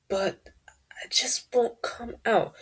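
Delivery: breathy whisper, voice barely there; words won't come out, just air; unvoiced whispered admission. Real, not acted.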